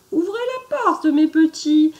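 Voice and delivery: sing-song it like a fake mum; sweet fake mother voice. The wolf sweetly pretends to be mum back from the meadow. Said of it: "dit d'une voix douce".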